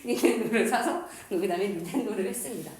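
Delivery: real laugh running into the words. Real, not acted. Teacher confessing they sold the shared console, laughing. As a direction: chuckle through the punchline. Amused by own console story.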